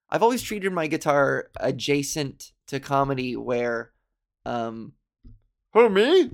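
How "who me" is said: higher, louder silly puppet voice; Jason the Muppet. Acted. Jason the Muppet interrupting the host, loud and silly. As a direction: bounce in as a goofy Muppet. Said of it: "that was Jason".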